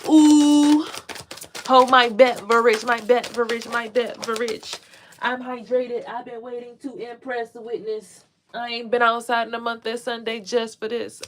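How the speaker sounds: high, loud showing-off voice; playful diva boast; sassy brag. Pretend. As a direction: brag about being hydrated, hammed up. Playful bragging bit.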